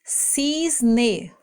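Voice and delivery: "Cisne" split as cis... ne; two stretched beats in "Cisne"; one syllable at a time. Shown as a demonstration. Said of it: "pronunciando uma sílaba de cada vez".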